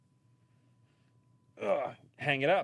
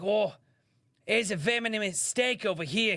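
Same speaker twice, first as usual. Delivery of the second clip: higher, louder excited Australian khaki-shirt showman; hammed-up Australian wildlife host, higher pitched; Steve Irwin impression; 11 semitones higher, 5 dB louder than usual. Streamer retells an Australian comic's Steve Irwin bit, higher, hammed-up Australian. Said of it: "Steve Irwin bit".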